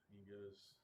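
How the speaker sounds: ordinary delivery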